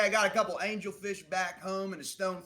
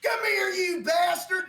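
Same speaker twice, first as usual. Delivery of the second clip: much higher, louder, slow charging yell; battle yell; 10 dB louder, slower than usual. Acted. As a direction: roar as you charge in with the net. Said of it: "and he goes".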